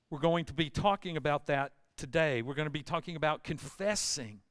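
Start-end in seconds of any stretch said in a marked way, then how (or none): none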